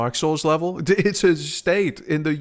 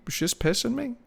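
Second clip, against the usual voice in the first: voice crack; 9 semitones higher, 5 dB quieter than usual. Real, not acted. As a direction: voice cracking high. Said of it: "Nice voice crack".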